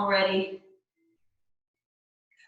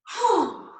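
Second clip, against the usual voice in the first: big, high audible sigh out; dramatic sigh; 9 semitones higher than usual. Shown as a demonstration. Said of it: "we're gonna sigh it out".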